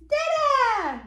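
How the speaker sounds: high, loud Dada sliding down; big playful singsong cheer; exclaimed refrain. Acted. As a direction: belt it out high for baby. Parent cheers book's refrain to child.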